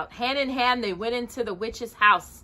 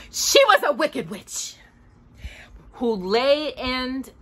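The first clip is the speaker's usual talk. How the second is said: gleeful; 4 semitones higher, slower than usual. A narrator's reading. Narrator relishes the witch.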